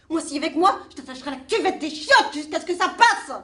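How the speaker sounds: high and loud, half-joking menace; loud crude threat. Acted. Mother vowing to force him, loud half-joking threat. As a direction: blurt the threat out at full voice.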